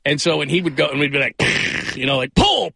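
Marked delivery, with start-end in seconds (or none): 2.4-2.7 high yelled pull, like calling for a clay target; sudden loud call of pull; shouted pull. Retold or imitated. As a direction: bark out pull for the skeet shot. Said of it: "shooting sounds".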